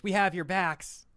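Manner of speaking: high, loud, slow mock promise. Streamer reads the Prime Minister's promise to parents, mocking. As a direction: blow up the promise in disbelief.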